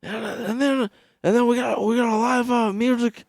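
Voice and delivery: high, loud announcer-ish rambling; mock old politician introducing an act; hammed-up Biden introducing a dead singer; imitating Biden. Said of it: "It's like".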